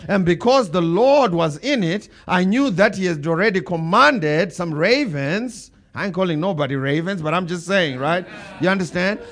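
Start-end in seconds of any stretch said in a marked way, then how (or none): 0.1-5.7 slow, loud, emphatic preaching. Acted. Pastor to his church, slow and loud preaching. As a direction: build it up for the congregation.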